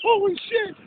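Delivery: loud, high-pitched retold panic; alarmed outburst in the retelling; freaking out. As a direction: exclaim it high and loud, reliving a bad trip. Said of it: "I was freaking out".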